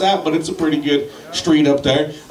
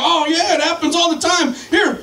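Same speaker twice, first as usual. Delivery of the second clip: helpful cop; 11 semitones higher than usual. Retold or imitated. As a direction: impersonate a friendly cop, pitched high. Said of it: "And he's like".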